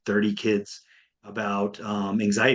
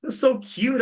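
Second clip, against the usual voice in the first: delighted; 13 semitones higher, slower than usual. Real, not acted. Delighted by the kid's remark.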